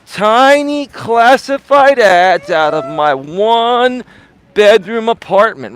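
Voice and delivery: TINY and ONE stretched long, high and loud; slow, loud infomercial pitch leaning hard on TINY and ONE; the emphasizer. Retold or imitated. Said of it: "I called him the emphasizer".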